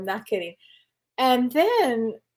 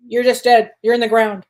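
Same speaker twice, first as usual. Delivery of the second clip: blunt grandma; 8 dB louder than usual. Retold or imitated. Grandmother's blunt answer to child.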